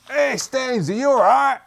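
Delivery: high, loud call to Staines; character calling out. Acted. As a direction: shout over to him, high-pitched.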